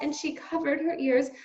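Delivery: ordinary delivery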